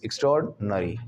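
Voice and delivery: एक्स्ट्राऑर्डिनरी as ik-STROARD, nuh-ree; right pronunciation. Shown as a demonstration. Teacher showing how to say it, merged pronunciation. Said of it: "इस तरीके से आपको बोलना".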